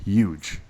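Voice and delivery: "Huge" as a lone "yuge"; "yuge", starting on a Y sound; dropped H in huge. Real, not acted. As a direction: say "Huge" without its H. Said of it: "you dropped the H".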